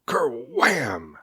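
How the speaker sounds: loud booming crash word. A narrator's reading. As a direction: slam it out like a hit.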